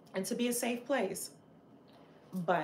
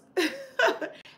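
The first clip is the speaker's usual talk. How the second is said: a bright burst of laughter, much higher and louder; sudden high laugh; 11 semitones higher, 7 dB louder than usual. Real, not acted. Streamer laughing at her own passion for the cause, a loud high laugh. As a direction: laugh out loud at how fired up she sounds.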